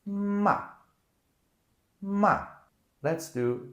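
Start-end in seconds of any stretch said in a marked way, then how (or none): 0.1-0.6 loud "Ma" starting high and dropping sharply; fourth tone, falling. Shown as a demonstration. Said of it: "starts high and it goes down". 2.0-2.4 loud "Ma" starting high and dropping sharply; fourth tone, falling. Shown as a demonstration. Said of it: "starts high and it goes down".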